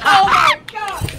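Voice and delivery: shocked yelling; startled shout. Real, not acted. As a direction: yell in real surprise. Fell out of chair.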